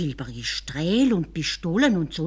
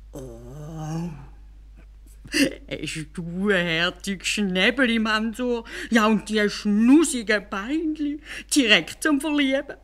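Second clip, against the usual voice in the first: langsam, zärtlich neckend, höher; schwärme wie über ein Baby; entzückter Kalif; 4 semitones higher, slower than usual. Acted. Kalif bewundert Storch-Mansur. (Kalif bewundert den Storch Mansur, zärtlich)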